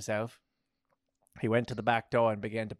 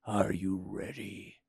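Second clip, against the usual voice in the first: slow, low and soft; lingering, soft goddess question; 5 semitones lower, 4 dB quieter, slower than usual. A narrator's reading. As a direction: ask it slowly in a hushed low voice. Goddess asks in slow seductive voice.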